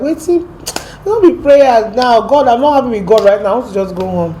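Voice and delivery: high, loud complaint easing off at the end; exasperated retold protest. Tired traveller protesting prayers to her friend, exasperated. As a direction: replay your fed-up protest, starting high and loud.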